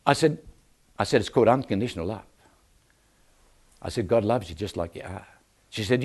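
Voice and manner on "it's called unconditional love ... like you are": low, soft and tender; tender reassurance. Retold or imitated. Preacher telling the barber softly, low tender reassurance. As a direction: say it gently to win her over.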